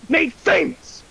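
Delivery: normal talking voice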